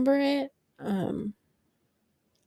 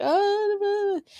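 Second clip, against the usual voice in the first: squeaky high mock of the flustered nurse, much higher; 12 semitones higher, slower than usual. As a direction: toss off a shrill-pitched mocking blah blah. Mocking the flustered new nurse.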